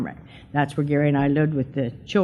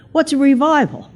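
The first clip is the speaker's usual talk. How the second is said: naive surprise; 10 semitones higher, 6 dB louder than usual. Retold or imitated. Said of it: "And I said".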